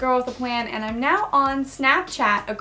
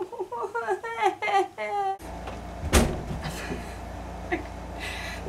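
giggling fit; 9 semitones higher, 8 dB quieter than usual. Real, not acted. Blooper, phone ringing.

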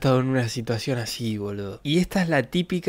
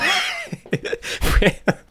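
burst of laughter. Real, not acted. Streamer laughing at a friend's joke, high laugh. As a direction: crack up at the joke.

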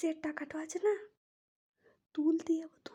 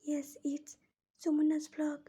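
ordinary talk

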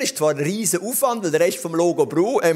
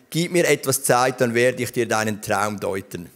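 ordinary talk

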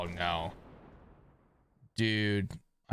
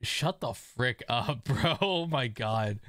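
ordinary talk

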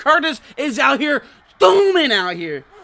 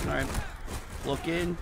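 ordinary talk, nothing marked

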